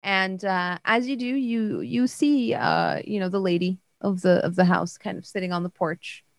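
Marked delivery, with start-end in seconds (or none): none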